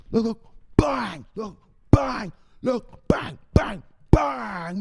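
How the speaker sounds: high, loud repeated shouts; bang yells. Real, not acted. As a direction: belt each bang out.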